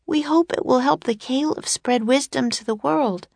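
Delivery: put-on high, earnest girl's voice; child's voice, pitched well up; young girl character. A narrator's reading. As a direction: speak up hopefully as a little girl.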